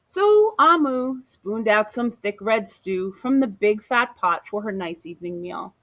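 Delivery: ordinary delivery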